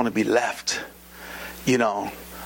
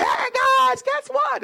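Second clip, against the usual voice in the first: high, loud eager greeting; excited announcement; 3 dB louder, much slower than usual. Retold or imitated. Couple calling her family on Skype, high excited. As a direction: bubble over with the news. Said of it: "We Skyped her family".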